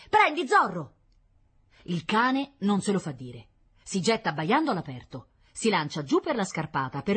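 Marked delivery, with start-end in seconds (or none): 0.1-1.0 high, loud call to a dog; shouted command. A narrator's reading. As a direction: call out to send the dog fetching. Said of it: "e grida". (comando gridato al cane)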